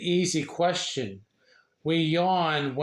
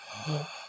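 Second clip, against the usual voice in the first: sharp gasp of air drawn in; big breath in; 9 dB quieter than usual. Shown as a demonstration. Reading a yawning book to viewers, a demo gasp. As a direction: show a big yawn breath with a quick gasp. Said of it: "we take a big breath of air".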